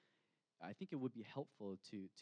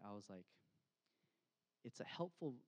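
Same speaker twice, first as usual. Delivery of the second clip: usual speaking voice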